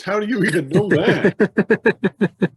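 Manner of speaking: high, loud and slow, stretched in disbelief; amazed. Retold or imitated. Bundy stunned on the phone with a fan, high slow amazed shout. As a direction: draw it out like a wrestler stunned by a fan.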